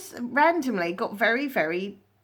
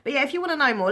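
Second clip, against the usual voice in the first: ordinary delivery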